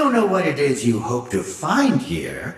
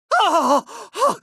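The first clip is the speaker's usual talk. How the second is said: very high, mounting cackle; triumphant evil laugh. Acted. Villain seeing it finally begin, ecstatic cackle.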